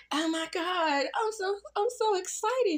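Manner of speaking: very high, breathless squeal of excitement; mock thrilled squeal. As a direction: squeal it like you can't contain yourself. Mimics Jackie's thrilled reaction.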